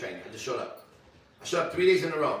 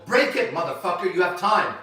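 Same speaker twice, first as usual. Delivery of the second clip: loud, raised-pitch defiant shout; re-enacted yell; 4 semitones higher, 7 dB louder than usual. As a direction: shout it back as a dare. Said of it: "I yell out".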